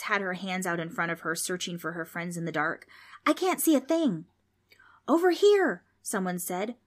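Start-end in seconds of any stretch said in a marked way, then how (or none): 3.3-4.2 scared. Acted. Kids lost in the dark. 5.1-5.8 calling out. Acted. Calling out in the dark.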